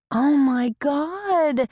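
high, drawn-out gasp of dismay; shocked. A narrator's reading. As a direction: let the shock stretch the words out. Neighbor learns girl ran away.

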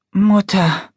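choked up. Acted. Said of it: "Christinas Stimme klang belegt".

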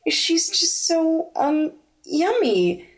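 purring. Acted. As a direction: savour the words teasingly. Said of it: "Ashley purred".

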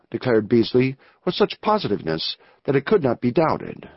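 ordinary delivery

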